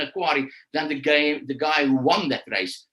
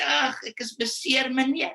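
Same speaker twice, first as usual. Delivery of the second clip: imitating a child faking hurt in Afrikaans; lightly whining Afrikaans kid; mock whiny fake injury; 7 semitones higher than usual. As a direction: play the schoolkid pretending to be injured. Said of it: "fake an injury and say".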